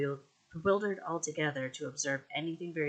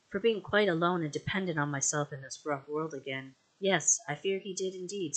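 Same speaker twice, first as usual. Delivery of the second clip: ordinary delivery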